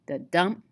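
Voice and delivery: "the dump" with a light "the" and a heavy "dump"; weak then strong, punching "dump"; iambic foot. Shown as a demonstration. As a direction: lean hard on "dump" after a quick "the". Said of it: "It's two syllables".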